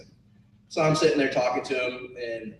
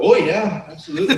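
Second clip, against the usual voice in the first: high, loud fake Irish confirmation; terrible Irish accent; 7 semitones higher, 8 dB louder than usual. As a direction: lay on a bad Irish accent with a laugh. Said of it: "talking in this terrible Irish accent".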